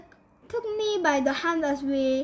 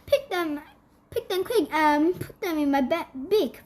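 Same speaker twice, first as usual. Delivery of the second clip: plain reading voice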